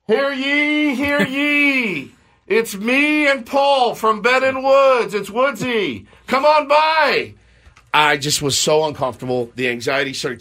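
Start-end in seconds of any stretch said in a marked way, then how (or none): 0.1-7.3 much higher and slow, hammed-up town crier call; mock town crier. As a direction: bellow it out like a silly medieval herald. Mock announcing at the table.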